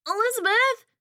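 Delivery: high yelled name from another room; boy screaming across the apartment. Acted. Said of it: "Henry screams from the front room".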